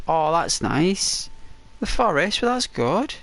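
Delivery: high, slow gushing reply; mock delighted reply. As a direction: gush back sweetly. Friend's reaction voiced in story.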